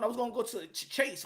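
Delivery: ordinary delivery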